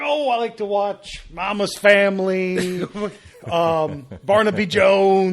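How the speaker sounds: lightly voiced pirate, slow and drawn out; a hint of an old sea dog, slow. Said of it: "he sounded like a pirate".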